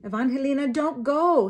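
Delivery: high, loud desperate call. A narrator's reading. Little brother calls after sister, loud. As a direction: cry after her. Tomas calling out.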